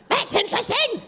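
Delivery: high squawky duck, dry. Acted. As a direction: play the duck, unimpressed. Duck character speaking.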